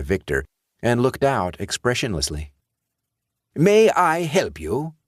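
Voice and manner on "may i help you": put-on slow, higher British accent from an odd bank teller; peculiar British accent. A narrator's reading. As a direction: ask it in a strange, stiff British accent. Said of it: "he asked in a peculiar British accent".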